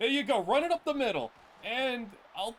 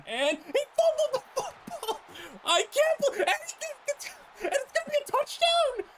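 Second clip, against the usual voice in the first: much higher, cracking, laughing outcry; shocked as the fumble gets returned; laughing disbelief; 3 dB louder than usual. Real, not acted. Gamer watches fumble returned.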